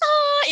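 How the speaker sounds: loud, high imitated crying; hammed-up wail of a crying child; mock child wail. Mother mimicking a child's tantrum, mock wailing. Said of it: "Anaknya nangis".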